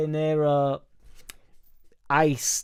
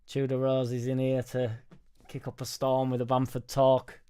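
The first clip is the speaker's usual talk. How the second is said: ordinary delivery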